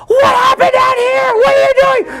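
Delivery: high, loud frantic mother's shriek; alarmed mom. Retold or imitated.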